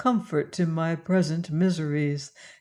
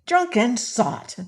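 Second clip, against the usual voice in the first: higher, slow contemptuous cry; scornful cry; 6 semitones higher than usual. A narrator's reading. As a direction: spit out the insult. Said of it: "cried she".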